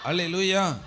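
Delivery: loud congregational call; loud hallelujah. Real, not acted. Preacher urging the congregation to say it loudly, loud call. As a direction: call it out to rouse the room. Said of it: "சத்தமா சொல்லுங்க".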